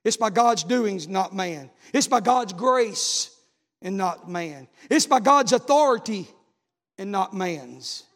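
slow, loud on God's, dropping on not man; preacher contrasting God with man; emphatic refrain. Acted. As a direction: hammer God's, let not man fall away.

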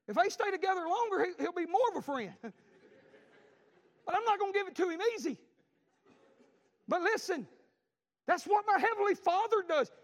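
loud, high preaching build. Acted. Preacher rousing the congregation at full force.

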